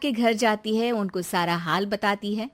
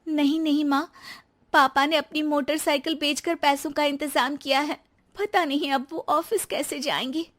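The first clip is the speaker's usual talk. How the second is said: lightly raised voice of a hurt new bride; young wife Rekha; 6 semitones higher than usual. Acted. New bride answers her husband, a touch hurt.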